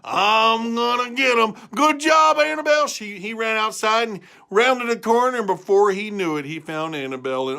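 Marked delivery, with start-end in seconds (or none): 0.0-2.9 high, loud shout; high farmer charging out; excited farmer. Acted. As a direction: holler with eager glee.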